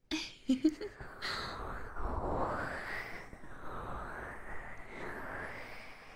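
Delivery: high, drawn-out evil giggle, breathy; teasing villain laugh; vampiric laugh. Acted. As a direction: let a long wicked giggle spill out. Vampire persona laughing at viewer.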